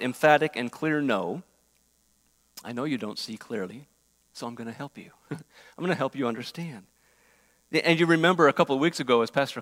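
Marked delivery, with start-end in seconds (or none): 2.6-6.9 softer, kindly voice of God; a hint of a tender God comforting Abram; gentle reassurance.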